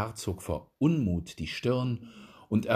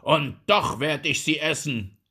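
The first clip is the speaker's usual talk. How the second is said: furious vow; 6 semitones higher, 6 dB louder than usual. A narrator's reading. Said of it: "rief er mit funkelnden Augen".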